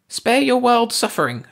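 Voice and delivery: high, stern warning; warning alien voice. A narrator's reading. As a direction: warn him off. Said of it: "Ursi warned".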